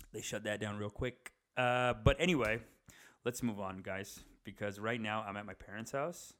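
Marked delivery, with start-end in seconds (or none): none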